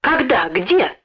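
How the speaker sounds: put-on suddenly animated boy; perked-up excitement. A narrator's reading. Boy hears news of thieves, perking up excitedly. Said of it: "оживился Лёнька".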